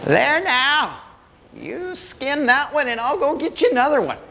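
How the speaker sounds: put-on high old-timer voice; old man. Old man shutting bear in with hunters, high old-timer voice. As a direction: say it high, as a sly old granddad. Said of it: "reached in to close the door and said".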